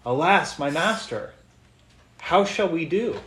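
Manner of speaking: lightly acted alarm, rising high on the last question; mildly freaking out. Servant to his master on seeing the city surrounded, mildly freaking out. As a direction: read it as a servant a little panicked. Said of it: "is freaking out a little bit".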